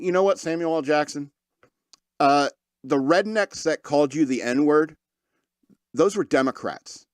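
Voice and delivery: normal talking voice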